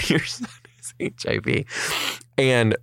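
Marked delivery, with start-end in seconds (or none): 0.1-1.6 hushed whispered news. Retold or imitated. Doctor telling the mother the bad news, whispered.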